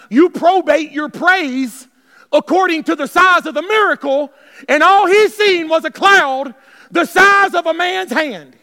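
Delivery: loud, slow, emphatic preaching; loud, slow preacher's cry; full-force preaching. Acted. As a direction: drive it home to the congregation.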